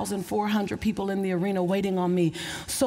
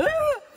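high burst of laughter. Real, not acted. Bursts out after a crying remark.

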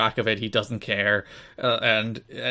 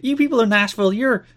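high, loud mock promo voice; hammed-up wrestler groping for an insult, long pause; Raven; 9 semitones higher, 4 dB louder than usual. Wrestler insulting the Nashville crowd, high halting promo voice. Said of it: "he starts talking about Nashville".

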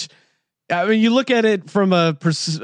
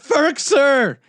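yelled name, much higher pitch; excited yell; 9 semitones higher than usual. Retold or imitated. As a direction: shout the name at the TV. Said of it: "I just got up and yelled".

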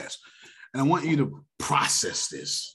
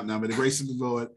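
ordinary delivery

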